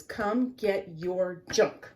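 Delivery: slow, loud, each word hit; slow firm announcement; slow emphatic. Acted. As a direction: read the sign boldly. Sign message punchline.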